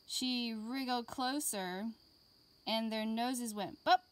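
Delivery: put-on lively read-aloud for young children; gentle classroom story voice; storybook reading. A narrator's reading. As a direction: read it like a picture book to kids. Reading picture book to class.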